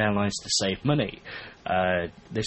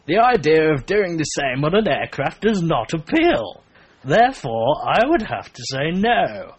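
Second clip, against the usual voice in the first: hammed-up posh London accent, higher and louder; stupid posh voice; 4 semitones higher, 5 dB louder than usual. Co-host reading a Londoner's online comment aloud, silly posh accent. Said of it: "I put that stupid voice on because she sounds very posh".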